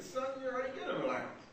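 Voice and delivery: lightly acted patient father; imitated father. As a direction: play a dad calmly informing his son. Father looking down at his son.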